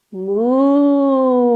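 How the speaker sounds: lowing moo like a cow; yoga teacher mooing for kids in cow pose. Pretend.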